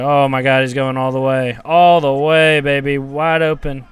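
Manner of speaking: loud, rising cheers as the run breaks free; real fan hollering at the TV; excited yelling. Real, not acted. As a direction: yell him all the way to the end zone. Long run by his own team.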